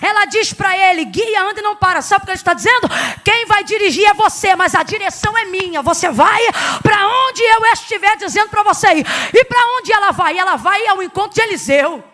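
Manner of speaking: loud, driving sermon voice; full-force preaching. Real, not acted. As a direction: preach it loudly, pressing every phrase home. (pregação forte, em voz alta)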